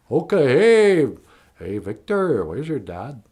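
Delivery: put-on thick rez accent with a DJ flair, opening high and loud; Smoke Signals accent plus DJ voice. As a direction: Smoke Signals accent, radio-host swagger. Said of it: "if you take that accent and you add it with a little D J voice".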